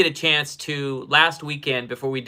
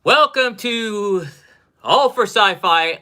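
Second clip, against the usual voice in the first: long, drawn-out high welcome, louder; announcer welcome; 6 semitones higher, 5 dB louder, slower than usual. Acted. Host greeting live viewers, drawn-out announcer welcome. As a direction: stretch it out like a show host.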